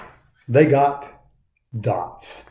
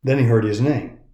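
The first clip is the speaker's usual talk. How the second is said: plain reading voice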